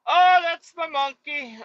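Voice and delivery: high, slow, put-on simple voice. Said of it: "He's like".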